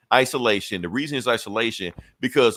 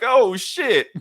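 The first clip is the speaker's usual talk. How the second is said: high, louder startled oh shit; mock alarm; 11 semitones higher, 5 dB louder than usual. Dealers realizing they have been trapped in the van. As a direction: yelp it as the trap shuts.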